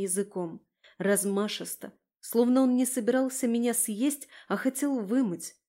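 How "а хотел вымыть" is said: ordinary delivery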